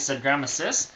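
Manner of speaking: ordinary delivery, nothing marked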